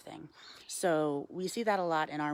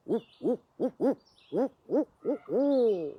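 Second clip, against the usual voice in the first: higher, louder hooting owl call, building at the end; louder barred owl call; 6 semitones higher, 7 dB louder than usual. Shown as a demonstration. Handler tries the barred owl call a second time, louder hooting. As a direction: hoot it out again like a barred owl. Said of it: "That was mediocre".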